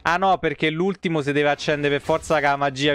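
ordinary talk, nothing marked

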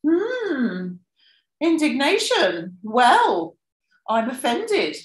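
high, rise-fall offended tone; mock indignation. Shown as a demonstration. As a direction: act hammed-up offended, pitch swooping up then down. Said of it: "the rise fall is".

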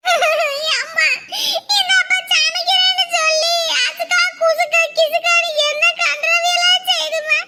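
high, slow sobbing complaint; wailing child. Acted. Boy wailing to mum about dad's cooking, slow and high. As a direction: cry out that dad's food is inedible. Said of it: "சோத்துக்கு தான் இந்த ஒரு ஒப்பாரியா?".

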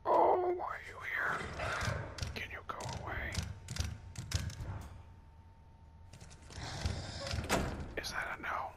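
unvoiced-soft whisper, politely put out; whispered plea. Real, not acted. Gamer asks the creature to go away, whispering. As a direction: whisper it as if not to be overheard.

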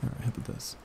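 ordinary talk, nothing marked

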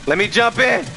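building from urgent to frantic yell; beg, then scream; panicked shouting. Real, not acted. Panicked escape, begging to get in.

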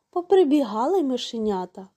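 usual speaking voice

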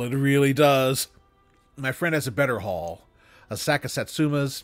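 ordinary delivery, nothing marked

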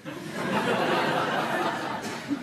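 high airy laugh. Real, not acted. Laughs after mother's punchline.

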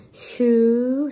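"Shoe" with the oo held long; drawn-out demo. Shown as a demonstration. Teacher building the word without the L, drawn-out demo. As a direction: stretch "Shoe" and leave it open for the next sound. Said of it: "La L no se pronuncia".

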